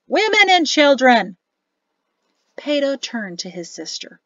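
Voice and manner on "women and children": louder, higher call; a warning yelled across the fire. Acted. Gazada calls over to singing Oblong, raised call.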